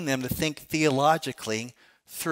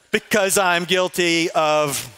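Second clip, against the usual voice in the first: slow, louder prompt for the crowd to finish; prompting the answer; 5 dB louder, slower than usual. Shown as a demonstration. Pastor leading the crowd through the catechism answer, slow and louder. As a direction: draw the answer out of them slowly. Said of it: "and you give me the answer".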